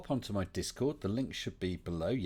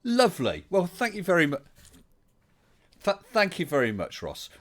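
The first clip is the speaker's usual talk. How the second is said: delighted; 10 semitones higher, 7 dB louder than usual. Real, not acted. Delighted reply to guest.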